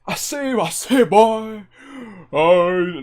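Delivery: high-pitched preacher stammering his opener; Reverend Pastor. Acted. Streamer attempts a half-remembered character, Reverend Pastor voice. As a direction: try out a reverend's exclaiming voice. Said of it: "the Reverend Pastor voice".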